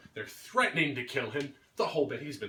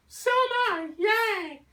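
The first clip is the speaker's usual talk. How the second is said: much higher, bubbly put-on voice; mock girly squeal; 4 dB louder, slower than usual. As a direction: squeal it like a giddy airhead. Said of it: "they walk away going".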